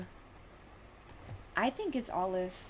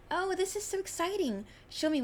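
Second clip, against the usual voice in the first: much higher, gushing excitement; thrilled; 10 semitones higher than usual. Acted. Friend gushing over the inventor's new prototype, thrilled and high. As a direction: squeal it like a fan waiting for a reveal.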